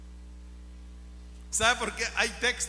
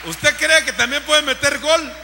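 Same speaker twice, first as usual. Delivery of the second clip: raised, loud preaching voice; rousing loud challenge; 5 semitones higher, 10 dB louder than usual. Real, not acted. Preacher challenging listeners to score goals, loudly. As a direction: fire the question out at the crowd.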